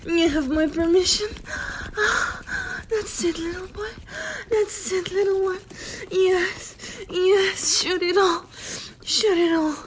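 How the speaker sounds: excited urging. Acted. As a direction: urge him on, rising to a peak. Roleplay climax, urging on.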